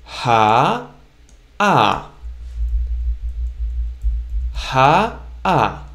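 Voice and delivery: "ha" then "ah" as two distinct syllables with a break; separated syllables. Shown as a demonstration. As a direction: separate "ha" and "ah" clearly. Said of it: "So together".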